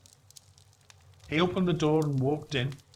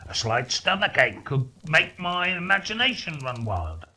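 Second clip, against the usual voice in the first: put-on louder, quick blustering old man; nervous scoffing miser; 4 dB louder than usual. Scrooge joking to keep down his terror, blustering louder. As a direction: bluff to mask fear.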